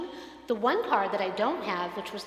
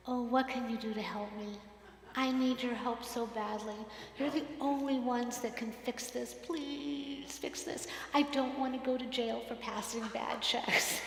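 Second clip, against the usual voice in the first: softer, fading to a whisper on "Please fix this"; sweet pleading; 8 dB quieter than usual. Retold or imitated. Pastor sweetly begging the dealership for help, quiet plea. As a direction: beg them gently, nearly whispering. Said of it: "I need an attitude adjustment".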